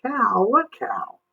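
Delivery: put-on high, confused small-boy question; puzzled boy. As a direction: ask baffled. Jimmy confused.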